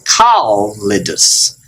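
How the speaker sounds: kalidus slowly as kal-lidus, holding the L; double L. Shown as a demonstration. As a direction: split kalidus between two L's. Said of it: "then they go separate directions".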